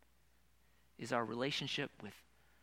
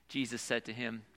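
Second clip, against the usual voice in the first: ordinary talk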